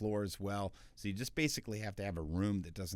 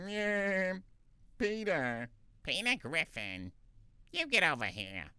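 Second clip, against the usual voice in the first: higher put-on cartoon voice; cartoon character voice; 9 semitones higher than usual. Shown as a demonstration. Voice actor demoing cartoon character voices. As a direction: toss off a cartoon sidekick line. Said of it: "a character".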